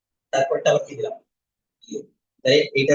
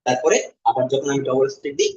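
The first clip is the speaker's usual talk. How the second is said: ordinary talk, nothing marked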